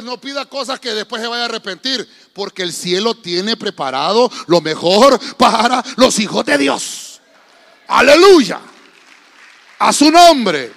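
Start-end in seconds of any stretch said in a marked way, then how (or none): 2.3-10.7 loud, building preaching cry, drawn out at the end; rousing preacher shout. Real, not acted. Preacher calling the congregation to praise, rousing shout. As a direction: shout it out to rally the church.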